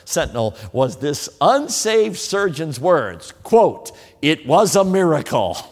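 slow, loud and ringing; proclaimed quote. Acted. Preacher quoting the surgeon to his church, ringing. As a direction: land the word miracle hard.

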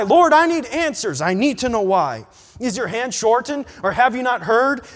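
high, loud protest to the Lord; indignant. A narrator's reading. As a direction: plead loudly, demanding an answer. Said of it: "my indignant reply".

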